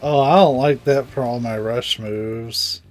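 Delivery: ordinary talk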